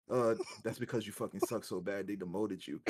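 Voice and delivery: imitating a deadpan put-down, low and soft; serious, sincere. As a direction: say it dead seriously, no laugh at all. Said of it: "he said it with such seriousness".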